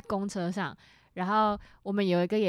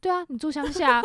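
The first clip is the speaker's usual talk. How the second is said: higher, louder matter-of-fact mom; mother's blunt reply; 7 semitones higher, 6 dB louder than usual. Retold or imitated. Mother bluntly agreeing with her daughter, higher and louder. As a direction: state it like an unbothered mother. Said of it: "然后我妈就说".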